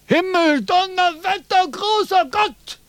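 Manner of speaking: very high, slow calling out; desperate cry. Acted. Philosopher calls on the god in frustration, high shout. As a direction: cry to the heavens.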